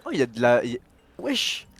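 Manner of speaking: ordinary talk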